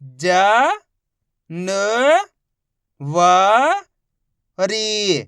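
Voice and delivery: ज, न, व, री each held and separate; drawn-out letter drill on ज, न, व, री; sounding out letters. Shown as a demonstration. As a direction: spell out ज, न, व, री with pauses. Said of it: "एक एक अक्षर का उच्चारण करके".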